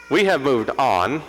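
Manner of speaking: ordinary delivery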